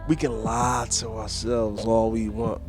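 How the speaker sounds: ordinary talk, nothing marked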